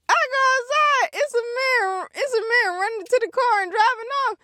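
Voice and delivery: high, urgent retelling of the theft; panicked disbelief. Retold or imitated. Telling friends his car is being stolen, high panicked voice. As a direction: voice jumps way up in alarm. Sees man driving off car.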